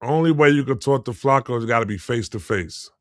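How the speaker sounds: normal talking voice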